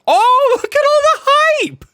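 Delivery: huge high-pitched hype yell; very loud, high streamer shout; over-the-top excitement. Pretend. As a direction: explode with hammed-up amazement. Feigned amazement at chat's hype.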